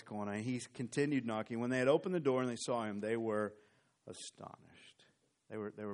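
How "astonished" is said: hushed, breathy word; whispered. Real, not acted. Preacher telling the crowd's astonishment, whispered. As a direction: whisper it for effect.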